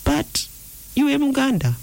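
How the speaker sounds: high-pitched voice of half-truths; insinuating inner voice. Acted. Deceiving voice speaking half-truths to you, insinuating. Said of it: "the voice can come and say".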